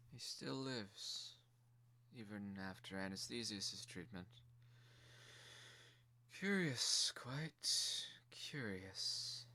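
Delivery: a ruling mother weighing her gift; stern quiet mother. Acted. Mother muses over the toy.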